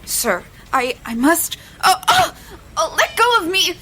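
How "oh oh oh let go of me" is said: frightened cry. Acted. Girl seized by a stranger in the alley, crying out in fear. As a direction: pull away from his grip, panicked.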